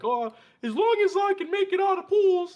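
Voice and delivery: high, loud mocking voice; mock boastful gamer. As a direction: sneer at the braggart in a high voice.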